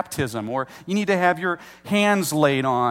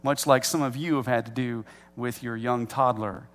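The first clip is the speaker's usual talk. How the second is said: normal talking voice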